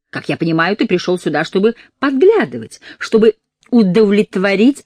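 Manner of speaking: rising into a loud fury; furious. Acted. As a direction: spit it out in rage. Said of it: "её бешенство глубоко и неподдельно".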